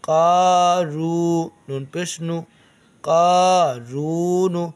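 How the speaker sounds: कारून slowly with रू held long; slow recitation drill; drawn-out vowels. Shown as a demonstration. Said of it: "रा वा पेश रू".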